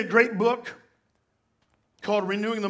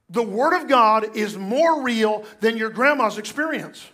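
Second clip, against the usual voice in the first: usual speaking voice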